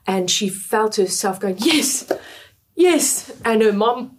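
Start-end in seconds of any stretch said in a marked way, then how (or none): 1.6-3.3 high, eager yes, yes. Retold or imitated. Girl answering the preacher's call, eager and high. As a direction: blurt it out, higher each time.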